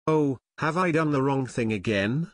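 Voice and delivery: plain reading voice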